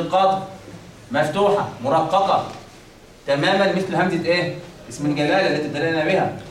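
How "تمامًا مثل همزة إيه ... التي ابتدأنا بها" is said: ordinary talk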